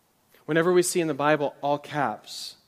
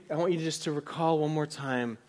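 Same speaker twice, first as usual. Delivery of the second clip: normal talking voice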